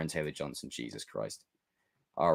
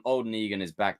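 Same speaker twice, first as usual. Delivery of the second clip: ordinary talk, nothing marked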